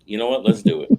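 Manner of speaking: high, loud burst of excitement; excited decision. Retold or imitated. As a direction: jump in all at once. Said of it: "And I said".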